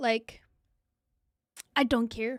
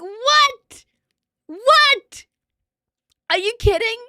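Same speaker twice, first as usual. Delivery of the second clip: higher, louder stunned what what; shocked disbelief; 7 semitones higher, 7 dB louder, much slower than usual. Retold or imitated. Streamer retelling Twitch's reply, shocked and loud. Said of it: "I was like".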